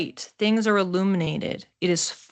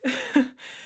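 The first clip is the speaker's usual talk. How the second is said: short laugh; 6 semitones higher than usual. Real, not acted. Brief laugh mid-forecast.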